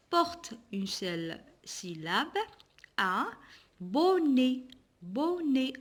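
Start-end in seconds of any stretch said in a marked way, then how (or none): 3.8-5.7 "Bonnet" in two drawn beats, bon then net; syllable by syllable. Shown as a demonstration. Teacher showing pupils two syllables, syllable by syllable. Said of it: "On a deux syllabes".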